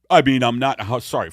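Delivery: burping while talking. Real, not acted. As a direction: talk through a burp. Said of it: "folks, I'm burping a lot".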